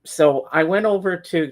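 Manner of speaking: ordinary delivery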